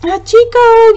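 high, loud acted cry of alarm. A narrator's reading. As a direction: call out the dog's name in distress. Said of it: "Yasuo cried".

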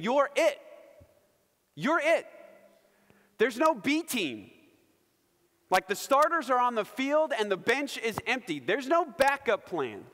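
high, punchy declarations with pauses between; Jesus, emphatically restrictive. Acted. Preacher voices Jesus telling followers there's no backup, emphatic. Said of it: "Jesus is being restrictive. He's saying".

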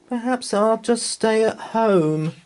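put-on high, small child's voice; little girl Anna. A narrator's reading. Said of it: "said Anna".

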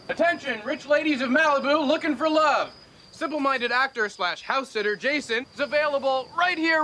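high, loud hawker's call; mock announcement. Joker advertises his friends to rich ladies, loud mock announcement. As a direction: shout it out to the whole neighborhood.

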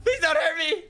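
laughing, high-pitched mock plea for mercy; cowering plea. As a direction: beg for your life, half laughing. Begging someone holding a katana.

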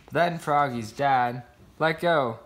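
usual speaking voice

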